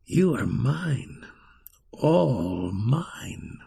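slow, swooping, sinister claim; evil enchanter. A narrator's reading. Fire wizard claiming the little child, slow menacing possessive voice. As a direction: draw it out slowly, sinking low on the end.

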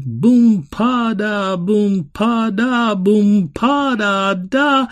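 loud, high, slow organ chant; carnival music oom-pah. A narrator's reading. Girl drowning a voice in carnival music, loud insistent chant. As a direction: pound out the beat to drown everything else. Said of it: "drown it in the carnival music".

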